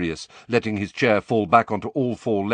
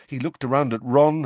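plain reading voice

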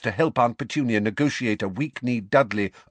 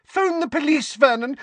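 high panicked shout; 4 dB louder than usual. Acted. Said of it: "Aunt Petunia screamed".

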